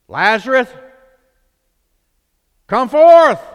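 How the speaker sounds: high, loud command; Jesus commanding into the cave; commanding call. Retold or imitated. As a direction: call the dead man out of the grave. Jesus calls into the tomb.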